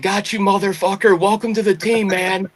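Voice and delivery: high, mock-jubilant gotcha; team's gleeful gotcha. Team erupting over the prank on their buddy, retold high and gleeful. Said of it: "my whole team just erupt laughing".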